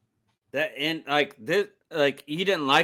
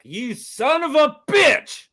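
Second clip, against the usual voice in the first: high, slow yell of rage; mock angry yell; 11 semitones higher, 8 dB louder, slower than usual. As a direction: bellow it like he's about to attack. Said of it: "he's like".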